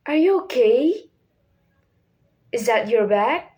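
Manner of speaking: yes-or-no questions with OKAY and BAG going up; rising intonation. Shown as a demonstration. Teacher showing learners example questions, rising at OKAY and BAG. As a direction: voice lifts at the end of OKAY and BAG. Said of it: "the voice rise at the end".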